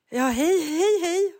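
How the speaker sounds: high, loud, flustered hellos; awkward nervous greeting. Retold or imitated. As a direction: greet like a nervous twelve-year-old. Awkward greeting to stern teacher. (nervös, hög och ivrig hälsning)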